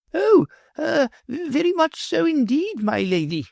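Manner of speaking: put-on flustered old professor, high and hurried; hasty reply. A narrator's reading. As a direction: blurt it out nervously. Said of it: "the professor hastily replied".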